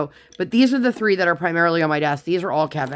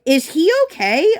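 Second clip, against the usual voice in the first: worried mom; 10 semitones higher, 5 dB louder than usual. Retold or imitated. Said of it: "my mom's like".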